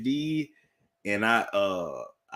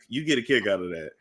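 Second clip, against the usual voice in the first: ordinary talk, nothing marked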